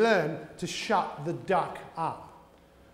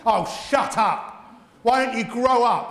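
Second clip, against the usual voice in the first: louder, slow dismissive snap; mock impatient parent, louder and higher; mock scolding; 4 semitones higher, 8 dB louder, slower than usual. Dismissive adult scolding a child.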